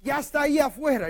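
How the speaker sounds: high, loud excited insistence; acted girl insisting loudly; insistent cry. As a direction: cry the news out to disbelievers. Acts Rhodes insisting.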